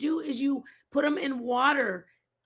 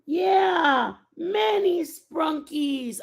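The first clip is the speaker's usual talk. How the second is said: put-on high, loud, slow excited little kid shout; imitating toddler; 5 semitones higher, 5 dB louder, much slower than usual. Mom imitating her toddler's excited shout. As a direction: squeal it like a thrilled three-year-old.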